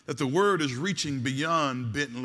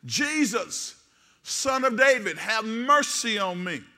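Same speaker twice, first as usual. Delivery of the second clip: light shout; 5 semitones higher, 4 dB louder, slower than usual. Acted. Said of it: "he began to shout".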